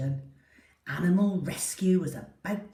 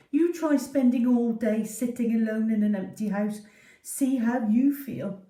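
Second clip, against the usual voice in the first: a touch louder and higher, huffy little dog; indignant dog voice; 5 semitones higher, 5 dB louder than usual. Acted. Poppy grumbling at the family, lightly voiced and huffy. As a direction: dare them to try her lonely day. Poppy complaining in her head.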